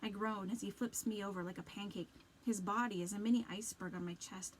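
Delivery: usual speaking voice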